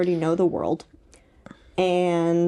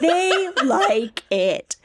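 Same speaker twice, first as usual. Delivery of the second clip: slow mock sarcasm; 8 semitones higher, much slower than usual. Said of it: "mocking the stance".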